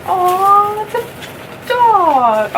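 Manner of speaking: high, slow delighted gasp at a dog toy; surprised squeal. Real, not acted. Unboxing a gift, she squeals at a dog.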